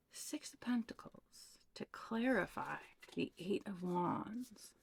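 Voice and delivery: hushed tarot whisper, slowing down. Real, not acted. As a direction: whisper the card names as if to yourself. Hushed card naming in reading.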